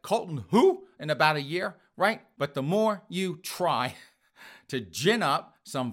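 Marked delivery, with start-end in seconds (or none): 0.0-0.9 mock dismissive. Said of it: "Everyone's gonna be like".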